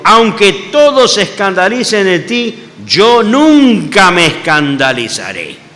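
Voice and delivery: loud, slow and emphatic; boastful vow. Acted. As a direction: Peter swearing he'll never fall, drawn out. Peter boasting he won't stumble.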